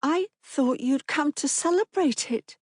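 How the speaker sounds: put-on high, hesitant child voice; a lightly voiced unsure girl; uncertain little girl. A narrator's reading. Daughter to father, high and hesitant. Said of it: "saying uncertainly".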